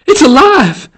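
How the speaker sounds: high, loud shriek of horror; scream. A narrator's reading. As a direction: scream it out in panic. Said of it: "she screamed".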